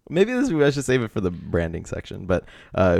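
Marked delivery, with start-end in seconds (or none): none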